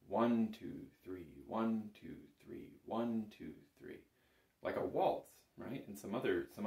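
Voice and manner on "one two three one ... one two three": one two three, accent on one; counting in three. Shown as a demonstration. Teacher counting a waltz for the bagpipe student. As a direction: count the waltz beats out evenly. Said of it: "we count".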